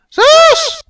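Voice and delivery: very high, loud nagging wife's yell; a nasal, twangy wife yelling at her husband. Acted. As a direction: shriek his name from the dark. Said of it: "a nasally, twangy voice yelled".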